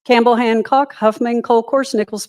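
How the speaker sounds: ordinary talk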